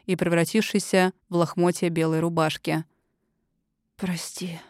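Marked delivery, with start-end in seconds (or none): 4.0-4.6 soft unvoiced whisper. A narrator's reading. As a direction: breathe it as a whisper. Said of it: "прошептала она".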